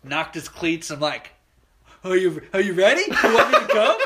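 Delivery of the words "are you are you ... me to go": eager rookie. Retold or imitated. Young pitcher asking the batter, eager and laughing. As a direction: sound like a keen rookie itching to pitch. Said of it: "I'm the excited young guy".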